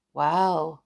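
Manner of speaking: usual speaking voice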